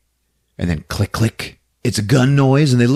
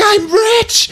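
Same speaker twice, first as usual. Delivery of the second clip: giddy; 3 dB louder than usual. Retold or imitated. Barbie grabs the money case.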